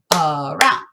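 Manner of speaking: A-round split into two loud beats; clapped syllables. Shown as a demonstration. Teacher claps around in two syllables. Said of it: "Can you clap the word around?".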